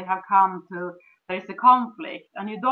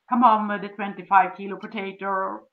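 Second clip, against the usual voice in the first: normal talking voice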